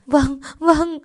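high, frantic agreement; frightened, hasty obedience; panicked assent. Acted. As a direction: answer in a fluster, pitch way up. Frightened girl obeys urgent orders.

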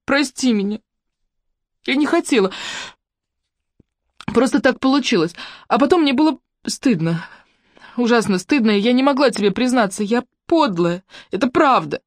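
high, plaintive female voice; tearful confession. A narrator's reading. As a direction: plead guiltily through tears. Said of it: "В трубке зашмыгали носом".

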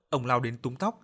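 usual speaking voice

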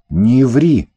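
резкий громкий окрик; рявкни коротко и громко; гаркнула. A narrator's reading. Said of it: "гаркнула я". (громко гаркнуть)

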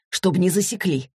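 plain reading voice

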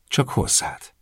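soft whispered teasing confession; a woman slyly whispering to the man she likes. A narrator's reading. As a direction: whisper it with a smirk.

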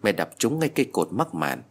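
plain reading voice

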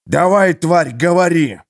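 злой рык, громче обычного; рявкнуть на пленника, требуя ответа; рык торговца. A narrator's reading. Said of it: "рыкнул торговец". (торговец рычит на избитого тюремщика)

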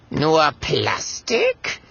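drawn-out, mildly indignant echo; trace of annoyance. A narrator's reading. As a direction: query it with slight irritation. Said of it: "schwingt eine Spur Ärger mit".

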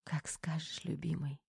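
very soft, loving murmur; quiet, gentle agreement; hushed tender reply. A narrator's reading. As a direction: answer softly so as not to wake them. Tearful reply to man falling asleep.

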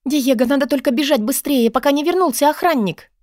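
high, agitated urging; high-pitched anxious haste; urgent Katarina. A narrator's reading. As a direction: press someone to flee at once. Katarina urges a hurried escape.

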